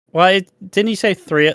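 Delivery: ordinary talk, nothing marked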